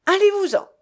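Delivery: high, loud cry of dismissal; shouted. A narrator's reading. Young woman shouting at a man before locking her door, angry cry. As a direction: shout him away. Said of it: "elle cria encore une fois".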